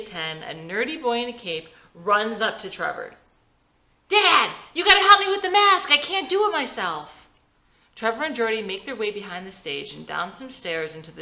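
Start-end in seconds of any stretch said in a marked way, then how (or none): 4.1-7.2 put-on high, loud kid voice; an eager ten-year-old; young boy. A narrator's reading. Jordy asking his dad for help with the mask, high eager kid voice.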